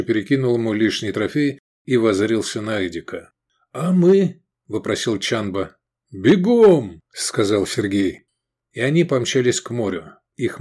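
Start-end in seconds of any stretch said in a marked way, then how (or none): 3.7-4.4 high, louder eager ask; young recruit. A narrator's reading. Recruit asks in battle. 6.1-7.0 loud, high command. A narrator's reading. Commander orders run.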